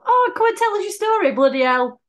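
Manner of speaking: high-pitched mock pleading with passers-by; hammed-up exasperated invitation; playful street coaxing. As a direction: coax strangers, pitched right up. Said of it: "Going out the streets telling people".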